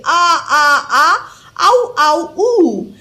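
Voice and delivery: mock wails of ah, ow, ooh; imitating her vowels. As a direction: copy her whining vowels. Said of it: "reproducing her vowels exactly".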